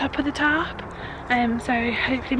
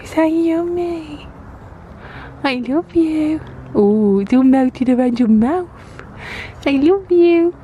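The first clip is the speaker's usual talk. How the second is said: put-on baby talk; 3 semitones higher, 6 dB louder than usual. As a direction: coo lovingly at the baby. Cooing at baby.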